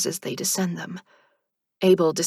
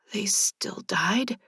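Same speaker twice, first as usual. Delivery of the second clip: slow, whispered dismay; dismal; 4 semitones higher, 3 dB quieter, much slower than usual. A narrator's reading. Narrator asks the reaper dismally about her friends. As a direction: let the question sink in despair. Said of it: "I ask dismally".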